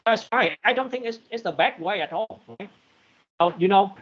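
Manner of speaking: glitchy robotic connection; choppy digital robot sound. Real, not acted. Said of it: "we see you talking like a robot".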